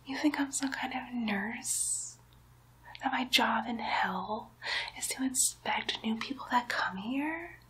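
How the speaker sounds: ordinary talk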